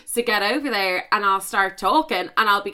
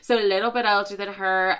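ordinary talk

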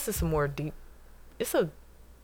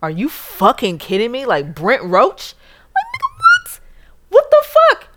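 louder, climbing much higher into an excited shriek; stunned excitement; 9 semitones higher, 11 dB louder than usual. Retold or imitated. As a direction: relive the moment the big booking came in. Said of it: "I was like".

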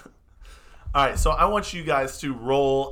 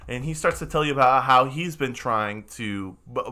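normal talking voice

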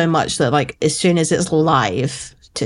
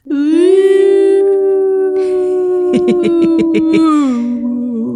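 long, high, loud howl; wolf howl; 13 semitones higher, 5 dB louder than usual. Shown as a demonstration. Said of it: "Sounds just like this".

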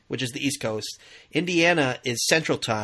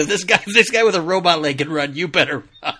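a hint of a stern coach, louder; stern gym teacher; 4 semitones higher, 7 dB louder, faster than usual. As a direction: push them to run, lightly mocking. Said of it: "It's like".

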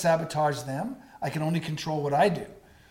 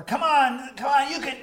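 higher, louder cheering yell; mock yelling; 9 semitones higher, 4 dB louder than usual. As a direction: imitate a pushy teammate shouting. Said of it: "when teams start yelling".

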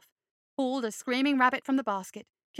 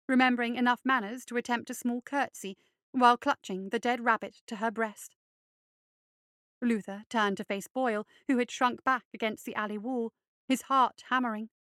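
usual speaking voice

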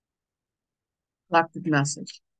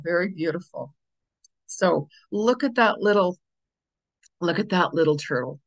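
normal talking voice

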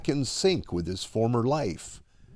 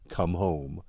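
tender call; 6 semitones lower, 5 dB quieter than usual. Acted. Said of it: "mouths two simple words".

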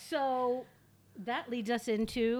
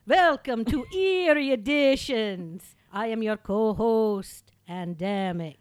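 witch voice; 9 dB louder, slower than usual. Acted. Witch voice opening the show.

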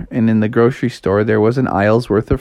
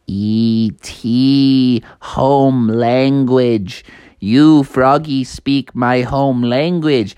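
slow, drawn-out alien voice; halting, slow alien speech; much slower than usual. Acted. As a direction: stretch each word out like a friendly movie alien. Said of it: "E T popped out and said".

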